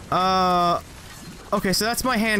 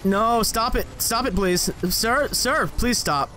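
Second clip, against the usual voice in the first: ordinary talk, nothing marked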